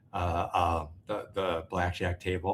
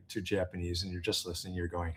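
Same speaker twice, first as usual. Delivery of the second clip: ordinary talk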